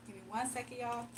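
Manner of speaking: normal talking voice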